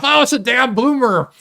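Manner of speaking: a hint of a whiny insult; mock annoyed quote. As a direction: toss off a mild mock complaint. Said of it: "Someone cucks me off".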